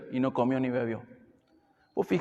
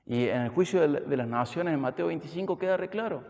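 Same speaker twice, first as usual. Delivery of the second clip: ordinary talk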